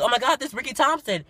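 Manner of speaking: loud, very high excited announcement; fan freaking out. Retold or imitated. Fan tells her coworker who is at the window, freaking out. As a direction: imitate a starstruck fan spreading the news. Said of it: "So she was freaking out".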